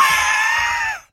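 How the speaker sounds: very high burst of laughter; braggart's laugh. A narrator's reading. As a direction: crack up at your own joke. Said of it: "lachte Breitling". (schallendes Lachen)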